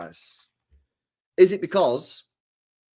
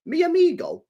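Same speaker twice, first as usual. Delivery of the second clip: "Mi amigo?" asked high and questioning; realising question; 14 semitones higher than usual. Shown as a demonstration. Streamer realising his phrase may not be French, questioning. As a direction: check "Mi amigo?" as a sudden doubt. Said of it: "Oh, wait a sec".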